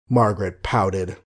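usual speaking voice

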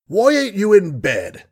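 a put-on stern scold that isn't really cross; mock sternness. Colonel to his frail daughter, pretending sternness. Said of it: "with as great an affectation of sternness".

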